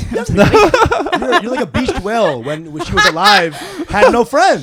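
high, loud laughing jab; laughing roast. Real, not acted. Roasting his friend as a beached whale, laughing loudly. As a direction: cackle through the insult.

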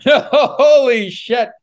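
loud, high and slowly drawn out through a laugh; stunned exclamation. Real, not acted. Host reacting to the cougar story, loud laughing disbelief. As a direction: let the shock burst out, laughing.

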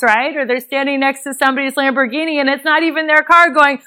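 usual speaking voice

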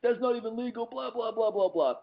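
high, shrill mock scolding; imitating angry lady. Chihuahua owner scolding about the loose dog, mock high rant. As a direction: squeal it like a furious dog owner. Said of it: "this lady just flips".

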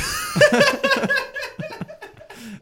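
squealing burst of laughter; high laughter. Real, not acted. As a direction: shriek with laughter. Cracking up at joke.

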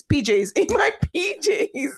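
giggling through P Js; laughing. Real, not acted. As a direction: laugh at the slip. Laughing at own slip.

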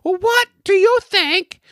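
shrieky high, slow British falsetto; Monty Python British. Acted. Host retrying an accent for a sample call, hammed-up British falsetto. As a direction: badly fake a Python-style British voice, stretched out. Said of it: "It's more like Monty Python style".

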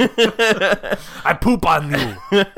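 very drawn-out comic dog voice; slow put-on insult-puppet catchphrase; Triumph the insult comic dog. Quoting the insult dog, slow drawn-out comic voice. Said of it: "Sounds like Triumph the insult comic dog".

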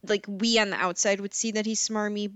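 ordinary delivery

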